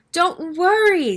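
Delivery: slow, soothing reassurance; calm the friends, slowly; reassuring. A narrator's reading.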